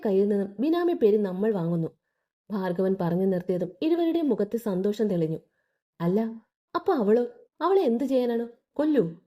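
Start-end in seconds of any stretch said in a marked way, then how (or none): none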